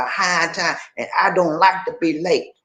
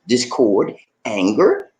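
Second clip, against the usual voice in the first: normal talking voice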